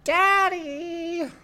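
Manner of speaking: put-on high squeaky toddler call; toddler calling daddy. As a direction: squeal it like a tiny child. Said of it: "giggling and".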